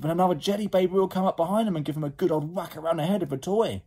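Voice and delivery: fast, high, breathless-paced narration; excited rush. A narrator's reading. Narrator tells the toy fight, excited rush.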